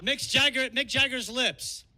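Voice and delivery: ordinary talk, nothing marked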